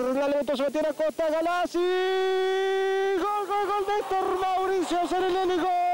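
climbs to very high goal shouts; goal scream. Real, not acted. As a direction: soar up into the goal call. Cross into goal.

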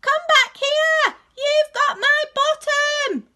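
very high, loud call across the sea; shouting. Acted. Bernard yelling to the rabbits at sea, loud. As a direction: yell it out to the rabbits far away. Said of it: "he shouted".

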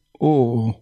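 a mild, drawn-out groan to oneself; understated moan of pain; inward groan. A narrator's reading. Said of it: "gemu în sinea lui cardinalul".

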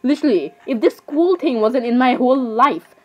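ordinary talk

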